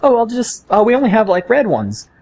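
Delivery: high mock clerk apologizing; imitating apologetic coworker. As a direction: gently let the kid down, high. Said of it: "she's like".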